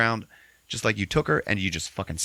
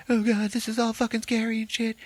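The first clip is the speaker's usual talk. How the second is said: much higher put-on frightened voice; mock scared Ashley. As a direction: squeal it in hammed-up fear. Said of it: "she's like".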